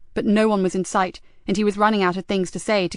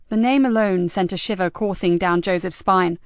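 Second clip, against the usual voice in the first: plain reading voice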